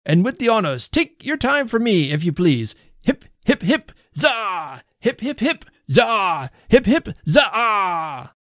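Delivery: high-pitched hip-hip-hurrah; shouted toast cheers. A narrator's reading. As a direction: lead the table in drawn-out cheers. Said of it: "shouted the indefatigable little man".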